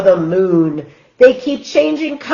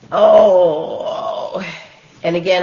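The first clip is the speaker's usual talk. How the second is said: plain reading voice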